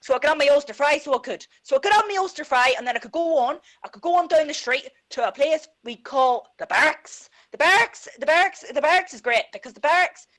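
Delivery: put-on thick Belfast accent on request. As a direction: tell it like a Belfast lad. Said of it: "do your Belfast accent".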